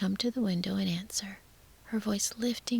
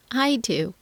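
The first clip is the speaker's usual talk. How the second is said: louder answer called down; calling back; 3 semitones higher, 9 dB louder than usual. A narrator's reading. Rapunzel answers the voice below her window, calling back. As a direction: call it back to someone below. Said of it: "she called back".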